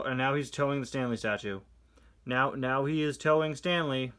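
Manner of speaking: ordinary delivery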